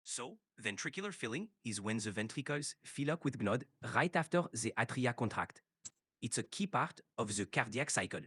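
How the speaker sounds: French-accented English with Mediterranean influence; French Cypriot accent. Shown as a demonstration. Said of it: "keep this accent".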